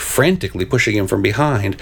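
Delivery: usual speaking voice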